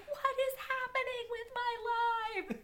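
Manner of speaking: high, amazed disbelief; incredulous. Retold or imitated. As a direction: marvel at it. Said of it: "I was like".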